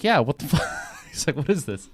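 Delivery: laughing disbelief. Retold or imitated. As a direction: act out his baffled outrage through a laugh. Retold indignant denial, laughing.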